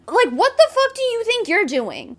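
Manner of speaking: high, exasperated rant; heated outburst. Real, not acted. Ranting at a commenter who used her son, exasperated. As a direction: fire it straight at her. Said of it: "I just got heated".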